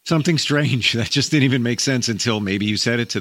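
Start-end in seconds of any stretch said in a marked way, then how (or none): none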